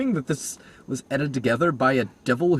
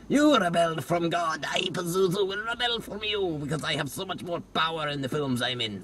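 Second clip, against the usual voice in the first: lightly voiced demon; 6 semitones higher than usual. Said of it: "show up and be like".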